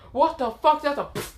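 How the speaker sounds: high-pitched startled protest; mock outrage. Kiba getting hit by a chair, high startled outrage. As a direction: squawk in surprise, then cut off.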